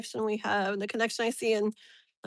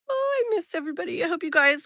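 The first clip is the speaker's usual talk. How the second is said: excited; 7 semitones higher, 3 dB louder, faster than usual. Real, not acted. Greeting returning viewers.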